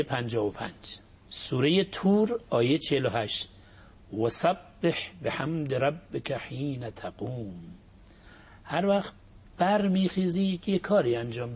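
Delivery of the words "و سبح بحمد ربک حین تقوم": slow, measured chanted verse; slow Quranic recitation. Acted. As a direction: recite the verse slowly and reverently.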